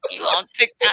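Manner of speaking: high laughing shout, teasing; laughing mock voice. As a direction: squeal it through laughter.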